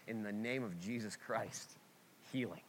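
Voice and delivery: quiet, firm prayer of healing; pastor's prayer. Retold or imitated. Pastor praying over a hospital bed, quiet and firm. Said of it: "wanna be the kind of pastor that walks in and goes".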